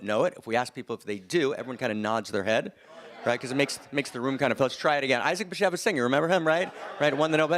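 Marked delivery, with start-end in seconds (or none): 4.6-7.6 enthusiastic. Shown as a demonstration. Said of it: "That's much better".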